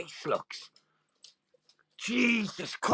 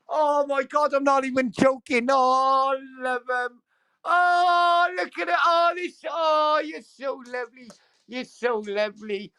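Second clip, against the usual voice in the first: excited cooing; 6 semitones higher than usual. Real, not acted. Cooing over the dolls holding hands.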